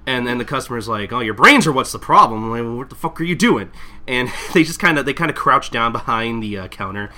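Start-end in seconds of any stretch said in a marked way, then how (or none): 1.1-3.7 loud angry retort; angry customer. Retold or imitated. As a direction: snap at them loudly.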